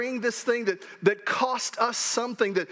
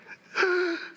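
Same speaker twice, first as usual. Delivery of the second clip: high sharp gasp of surprise; surprised gasp; 10 semitones higher than usual. Retold or imitated. Father gasps high on seeing the Rose Bowl ticket. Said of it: "And I was like".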